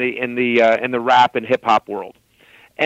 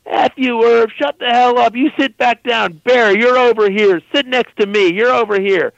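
mock bossy shout; 7 semitones higher than usual. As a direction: order everyone where to sit. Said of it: "he's just like".

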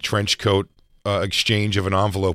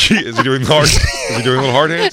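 laughing, loud; 4 semitones higher, 8 dB louder than usual. Real, not acted. Laughing, building the bit.

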